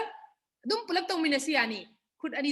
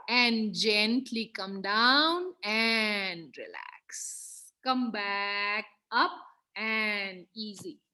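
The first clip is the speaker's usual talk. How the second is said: slow soothing cue; 4 semitones lower, much slower than usual. Real, not acted. Yoga cue, drawn out.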